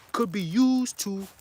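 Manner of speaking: slow it right down to let it sink in; read slowly. Shown as a demonstration. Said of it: "gonna read that again slow".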